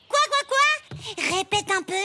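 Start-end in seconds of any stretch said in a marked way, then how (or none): 0.1-0.6 indignant. Acted. Girls' row with the boys.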